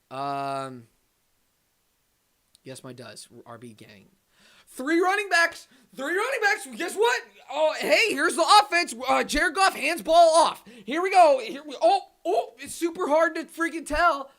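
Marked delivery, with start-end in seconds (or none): 4.7-14.3 high-pitched, loud mocking rant; worked-up fan shouting high; sarcastic rant. Real, not acted. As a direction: rant in shrieky, sarcastic disbelief. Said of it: "the whole entire rant".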